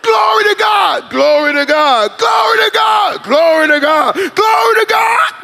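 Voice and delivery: high, shouted, half-laughing praise; yelling out. Retold or imitated. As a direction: yell it mindlessly like someone caught up in a crowd. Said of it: "start yelling out stuff".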